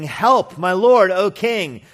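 loud, high-pitched plea; shouted appeal for rescue; crying out for help. Acted. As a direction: call out urgently to the king above. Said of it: "a woman cried out to him".

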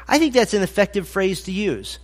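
ordinary delivery

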